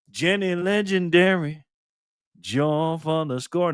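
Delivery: slow, stretched hype announcer call. Acted. Host welcomes a member like a sports announcer, drawn out. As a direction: boom it out like a ring announcer.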